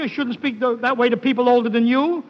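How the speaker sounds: usual speaking voice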